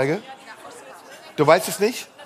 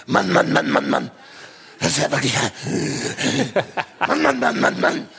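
breathy, soft, overbreathed tough guy; Götz George style; 4 dB quieter than usual. Pretend. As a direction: breathe through every word. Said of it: "Götz-George-Style. Alles zeratmen".

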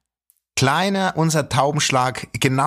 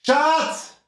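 yelling across the room; 11 semitones higher, slower than usual. Real, not acted. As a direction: shout for her from afar. Calling wife from another room.

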